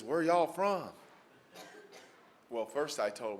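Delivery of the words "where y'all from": lightly drawled Southern y'all, higher and louder; imitating a folksy Southern judge. The judge greeting the lawyers in chambers, a Southern lilt. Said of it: "He says".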